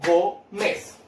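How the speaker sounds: "Gómez" broken into two syllables, Gó, mez; separado en sílabas. Shown as a demonstration. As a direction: say "Gómez" with a gap between its syllables. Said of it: "Separo en sílabas". («Gómez» separado en dos sílabas, Gó-mez)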